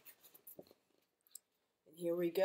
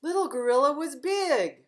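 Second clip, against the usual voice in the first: higher, louder triumphant line; big reveal; 7 semitones higher, 10 dB louder than usual. A narrator's reading. Librarian revealing to kids, loud and high. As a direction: announce it with a big flourish.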